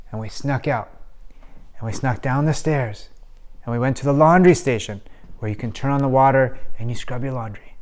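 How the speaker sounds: soft, sneaky storytelling; quiet, secretive voice; hushed narration. Acted. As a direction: tell it like you might get caught. Sneaking out at night.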